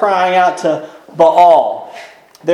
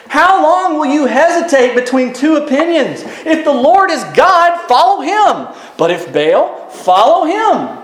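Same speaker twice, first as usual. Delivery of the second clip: raised, emphatic challenge to a crowd; 7 semitones higher than usual. Acted. Elijah confronts the people torn between two opinions, challenging. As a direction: put it to the waverers, pitched up.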